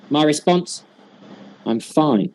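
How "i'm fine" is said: low 'I'm fine' with a falling end; lowered, unconvincing answer; not sounding fine. Shown as a demonstration. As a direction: claim to be fine without sounding it. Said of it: "he doesn't sound fine".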